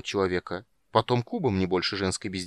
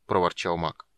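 ordinary delivery

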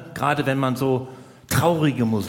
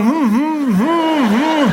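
high-pitched hesitant hums; high mock humming; 11 semitones higher, 6 dB louder, much slower than usual. Comic trying to object to Hilde with hums. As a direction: squeak out little hums, unsure. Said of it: "Und ich wollte noch sagen".

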